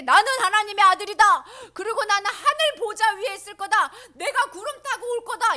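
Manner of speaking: high, loud mock declaration; sudden proclamation. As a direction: blurt out grand claims out of nowhere. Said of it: "가만히 있으셨는데 갑자기".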